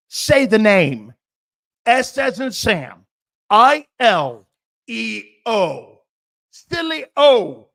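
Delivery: loud, slow, letter by letter; forceful spelling. Real, not acted. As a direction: drill the name into them. Host spells his name, demanding.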